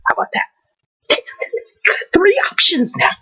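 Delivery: voiceless whisper, leaning on "three". Real, not acted. Counting his options for the listener, whispered. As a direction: hush it, stressing "three".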